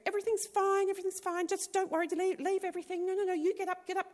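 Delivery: high, loud, urgent rush of orders; frantic reassurance. Retold or imitated. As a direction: hurry them along, insisting all is fine. Frantic sister hurrying her out.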